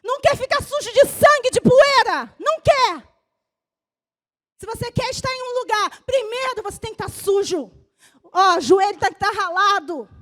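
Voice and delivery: loud, driving sermon climax; fiery preaching. Real, not acted. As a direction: preach it hard to the church. Pastor preaching at full force. (pregação inflamada e forte)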